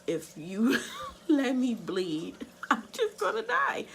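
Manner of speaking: breaking into high-pitched laughter mid-sentence; laughing. Real, not acted. Patient retelling her fear of bleeding to death, laughing.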